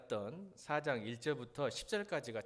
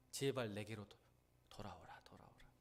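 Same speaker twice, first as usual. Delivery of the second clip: plea fading to a whisper; tearful whisper; 4 semitones lower, 5 dB quieter than usual. Acted. Preacher voicing God's tearful plea to Israel, whispered. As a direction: beg softly, almost breathless, as if in tears. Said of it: "눈물을 흘리면서 한 거죠".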